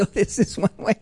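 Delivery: real chuckle through the words; laughing. Real, not acted. Host mocking the UN news to listeners, laughing. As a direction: laugh as you land the joke. Host joking about leaving the UN.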